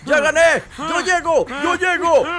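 triumphant shouting. Real, not acted. Gamer cheering as he reaches the finish, triumphant shouting. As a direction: shout it out, thrilled to be winning. Player celebrating winning the race.

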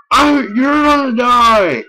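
high loud slow cry; real angry yell. Real, not acted. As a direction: yell a threat at the screen. Gamer raging at Pikachu.

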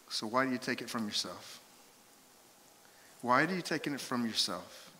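suddenly soft, searching question; hushed question. Real, not acted. Preacher to congregation on self-talk, hushed appeal. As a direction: drop right down and ask gently.

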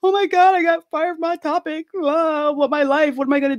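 high, wailing mock sob over losing the job; retold bawling. To her mom after being fired, a high retold wail. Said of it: "I'm bawling".

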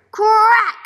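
teacher showing a loud crack. Shown as a demonstration. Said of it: "Why did I read it like that?".

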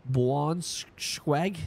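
usual speaking voice

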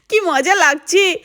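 high giggle rolling into slow happy words; laugh, then savour the fun; a child laughing, delighted on her tube; laughing with glee. Acted.

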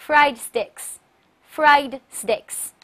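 fried steaks drawn out very slowly; very slow model reading. Shown as a demonstration. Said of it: "再读一遍". (极慢示范fried steaks)

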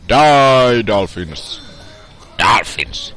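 loud yell at the enemy; battle shout. Real, not acted. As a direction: shout it out, starting high. Shouting at enemies in game.

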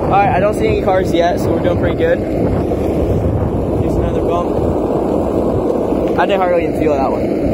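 loud, jolted speech while riding fast; on a bumpy road. Real, not acted. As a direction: shout over the wind on a bumpy ride. Riding downhill over bumps.

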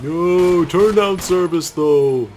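high, loud, slowed goofy voice; silly high voice. Acted. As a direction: ham it up in a squeaky goof voice. Mock voice at the game.

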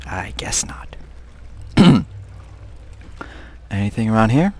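ordinary talk, nothing marked

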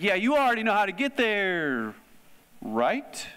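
high, pointed teacher's hint, ending in a question; knowing teacher tease. Retold or imitated. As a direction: tease them like a teacher who knows they know. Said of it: "Like a teacher might do that".

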